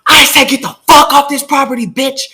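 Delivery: high, loud yell at the door; retold yell. To the woman banging on the door, a high yelled order. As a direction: fling it at her, high and loud.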